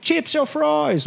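high, slow mock British accent. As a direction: draw it out in a hammed-up British accent. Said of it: "British accents".

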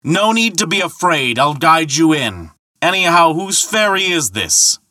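high, carrying shouted demand; roaring. Acted. Thor pressing the ferryman from the bank, roaring. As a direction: keep shouting it over the water. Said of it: "roared Thor".

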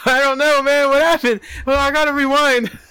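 lightly voiced dazed stoner rewinding a film; baffled stoner. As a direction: sound lost and stoned. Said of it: "I'd be like".

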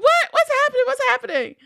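high-pitched mock alarm; acted high, urgent questioning; alarmed mom. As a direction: call out in confused panic, imitating mom. Voices alarmed mom calling out.

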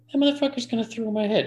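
a sudden jolt of disbelief; alarmed realization. Retold or imitated. Seeing the bassist eye his amp head, retold alarmed realization.

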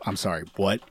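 lightly voiced Kiwi, taken aback; mock a baffled reaction, low and soft; puzzled Kiwi. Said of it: "a Kiwi in the Discord who's just gonna be like".

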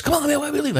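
high complaining buddy; whining. Retold or imitated. Buddy complaining about leaving early, high and whiny. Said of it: "He goes".

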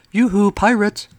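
mocking singsong hail; taunting call. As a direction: tease the pirates from afar. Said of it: "the serpent called".

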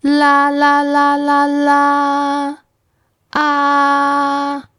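la and ah sung on one high note; every "la" and "ah" on the same high pitch; high flat tone. Shown as a demonstration. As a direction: chant "la" and "ah" high and level. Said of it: "sounds a bit like when you're saying".

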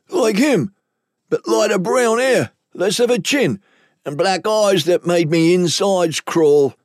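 high captive voice, slowing at the end; frightened lowlife. Acted. Captive describing his employer to interrogators, high and uneasy.

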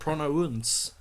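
"Pronounce" as pro-no-unce; joking over-rounded "Pronounce"; mangled pronunciation. Shown as a demonstration.

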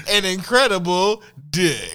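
loud high punchline; loud reveal. Acted. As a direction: belt out the reveal.

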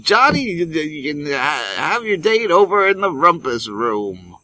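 high, loud wholesome fifties dad; cheery old-timey TV father; 1950s sitcom dad. Pretend. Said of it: "That's my fifties dad voice".

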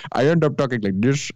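slipping into an Indian accent; lightly imitated Indian accent; mock Indian accent. Shown as a demonstration. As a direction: demonstrate a put-on Indian accent. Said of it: "I turn this into Indian".

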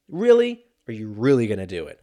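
a squeaked, outraged double take; incredulous. Retold or imitated. Narrator imagines confronting the rude lady, loud disbelief. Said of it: "So I was like".

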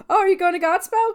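high, loud, fast mocking squeal; mock obnoxious. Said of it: "it's just so obnoxious".

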